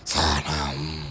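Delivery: very low, long drawn-out call; deep, dragged-out monster voice; ghostly parent calling. Acted. Ghost parent searching for the daughter, slow deep eerie call.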